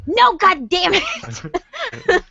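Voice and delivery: slow, high, laughing exasperated cry; mock frustration. Finding the killer on cue, mock frustrated wail. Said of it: "And I'm like".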